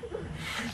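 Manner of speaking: long breathy exhale; tired sigh. Real, not acted. Groggy after dental surgery.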